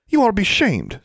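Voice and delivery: put-on high-pitched, reproachful girl's voice; indignant girlish reproach; scolding girl. A narrator's reading. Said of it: "said Forbearance".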